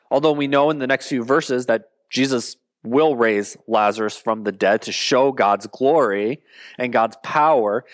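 normal talking voice

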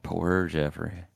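soft, slow mock sympathy; mildly upset. Host retells a man pitying a character, mildly upset. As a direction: retell it softly and slowly, a touch upset. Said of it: "he starts to get upset a little bit".

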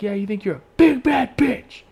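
high, loud confrontational yell; retold shouted taunt. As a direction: re-enact taunting a driver from afar. Re-enacts taunting fleeing driver.

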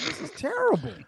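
high, put-on appalled voice; mock outrage. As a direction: squeal it like it physically hurts. Retold reaction to Tretch's line.

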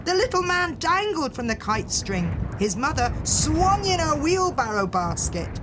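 ordinary delivery, nothing marked

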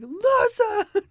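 put-on sudden high, loud bellow at the kids; one-word yell to stop the kids; mom bellowing voice. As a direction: blast it out like a fed-up mom. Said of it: "my deep mom bellowing voice".

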